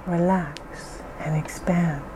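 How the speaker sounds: soft slow whisper. Acted. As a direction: breathe it out, barely voiced. Soothing guided relaxation script.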